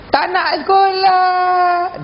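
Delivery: high, long drawn-out wail; exaggerated schoolchild tantrum wail; mock crying child. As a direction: bawl like a kid refusing school. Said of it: "Nangis lagi".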